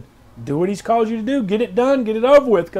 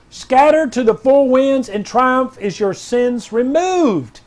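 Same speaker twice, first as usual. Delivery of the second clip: higher, louder, commanding declaration; prophetic proclamation; 5 semitones higher, 4 dB louder, slower than usual. Acted. Pastor reading R J W's word, loud and commanding. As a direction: thunder it out like a prophet.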